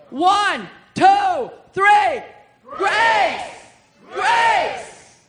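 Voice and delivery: loud, high count-in and shout; rallying shout. Real, not acted. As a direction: lead the crowd in a full shout. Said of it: "I want you to shout it with me".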